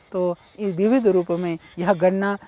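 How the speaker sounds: ordinary delivery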